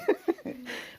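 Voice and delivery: high burst of laughter. Real, not acted. Lecturer laughing at Ramakrishna's refusal, high laugh. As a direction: laugh at the cheek of it.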